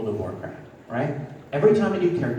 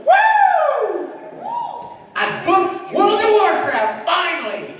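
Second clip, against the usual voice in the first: a much higher, louder joyous shout; excited whoop; 5 dB louder, slower than usual. Retold or imitated. Retelling his first reaction to booking the role, high excited whoop. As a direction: burst out with thrilled disbelief. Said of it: "my first response was".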